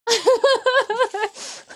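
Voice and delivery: bright burst of laughter; a quick high laugh. Real, not acted. Laughs at herself.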